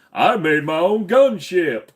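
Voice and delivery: mock fan boast. Mocking a fan's tweet.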